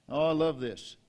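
ordinary talk, nothing marked

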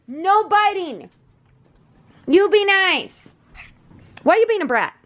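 high sing-song telling-off; scolding puppy. Real, not acted. Scolding puppy after bite.

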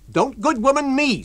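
high, loud snap back; Mrs Hall, indignant. Acted. Landlady rebuffing the lodger. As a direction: refuse to be patronised.

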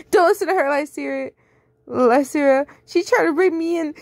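pleading falsely to her friend; whiny liar. Acted. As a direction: Sue begs Lysira, high whine. Sue pleading to Lysira.

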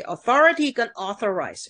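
"authority" on THOR against "authorize" on AU; contrasting stress. Shown as a demonstration. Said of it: "是不一样的". (“authority”重读第二音节，“authorize”重读第一音节)

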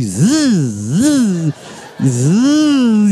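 long buzz rising and falling; imitated buzzing. Son imitating the sound by mother's room, swooping buzz. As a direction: drone a swooping motor-like zzz. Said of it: "escutei um zzz".

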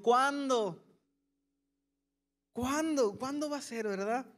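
nagging God, a touch whiny; impatient asking. Retold or imitated. Preacher voicing his own impatience, mock pleading. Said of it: "Digo conmigo".

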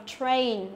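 "train" drawn out with a clear "tr" and long A; slow, falling model of "train"; teacher's careful "train" with r and ay glide; correct model. Shown as a demonstration. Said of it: "Thì cái từ này đọc là train".

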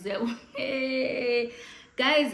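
breaking into laughter mid-word; high, loud burst of laughter; laughing. Real, not acted. Vlogger cracking up at her own gossip, laughing.

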